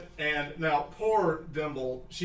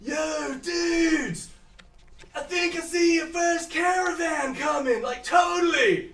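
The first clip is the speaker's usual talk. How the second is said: high, loud dude shout from afar; surfer-dude lookout calling; 13 semitones higher, 4 dB louder, slower than usual. Acted. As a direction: call it across town. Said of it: "you hear someone calling".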